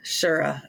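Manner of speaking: low "Shura" in two syllables, SHOOR-uh; "Shura" with an extra uh, pitched low; echoed bad pronunciation. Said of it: "She made it two syllables".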